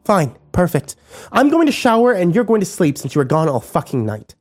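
high, biting sarcasm; mock him coldly; scornful female voice; sarcastic contempt. A narrator's reading.

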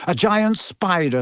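plain reading voice

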